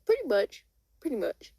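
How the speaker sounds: high-pitched, falling agreement; breezy confirmation. Acted. Carol confirms the alien news.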